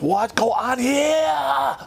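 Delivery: mock broken-English sergeant major.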